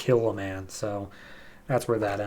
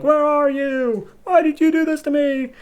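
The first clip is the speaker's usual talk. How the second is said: screaming out. Retold or imitated. Heroine calling into the wreck for her clone, high anguished cry. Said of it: "So she screams out".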